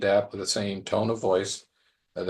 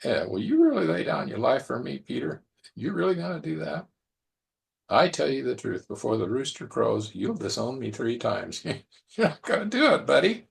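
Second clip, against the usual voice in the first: playful, knowing doubt, rising to a chuckle; lighthearted ribbing with a high, amused lilt; teasing, with a wink; 3 semitones higher than usual. Acted. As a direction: gently kid a friend who is overpromising. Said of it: "With a twinkle in his eye, with a wink and a nod?".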